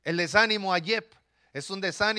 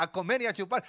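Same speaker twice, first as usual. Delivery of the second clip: normal talking voice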